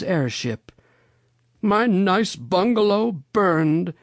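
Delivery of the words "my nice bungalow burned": high, drawn-out lament; dismayed exclamation. A narrator's reading. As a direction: wail mildly over the loss, slowly. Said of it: "exclaimed the rabbit gentleman".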